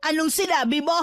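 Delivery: outraged mother; affronted demand. Acted. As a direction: demand an answer to the insult. Mother reacts to insult of son.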